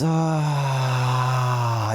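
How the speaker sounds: long exhaled ah sound like a sigh. Shown as a demonstration. Teacher demonstrating the waterfall breath, sighing ah. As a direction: let the breath fall out as an open ah. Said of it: "you hear the ah sound".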